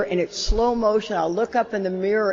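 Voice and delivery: ordinary talk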